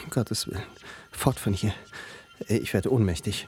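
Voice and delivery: horrified. Acted. Horrified flight from the room.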